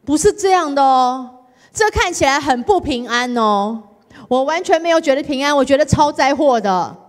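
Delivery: loud, high protest to the Lord; complaining to Jesus. Retold or imitated. Retelling her prayer to Jesus, loud whiny protest. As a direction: whine your case at Jesus. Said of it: "我说：".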